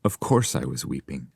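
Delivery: ordinary delivery, nothing marked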